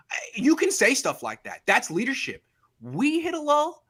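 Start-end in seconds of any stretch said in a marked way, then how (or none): 2.8-3.8 sarcastic echo. Real, not acted. Mocking the quarterback's words again.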